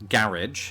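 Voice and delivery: GARAGE as "GARR-idge"; British first-syllable GARAGE. Shown as a demonstration. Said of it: "as I would say".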